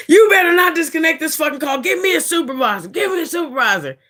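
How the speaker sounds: high, nearly crying demand; desperate yell. Retold or imitated. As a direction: beg and yell at once. Caller yelling, near tears.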